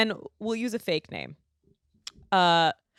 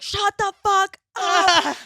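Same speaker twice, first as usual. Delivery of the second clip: angry; 13 semitones higher, 7 dB louder, much slower than usual. Retold or imitated. Silencing annoying date.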